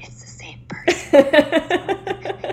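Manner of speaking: drawn-out, high, breaking into whisper; high hushed aside. Retold or imitated. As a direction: squeak it slowly to a friend, mock-amazed. Retold amazed aside to a friend.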